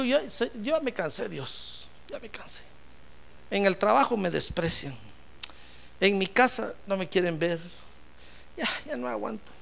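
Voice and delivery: soft, worn-out plea to God; weary lament. Acted. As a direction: act out a quietly exhausted man. Jacob vencido se queja a Dios. (lamento cansado en voz baja)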